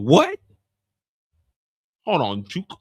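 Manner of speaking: ordinary talk, nothing marked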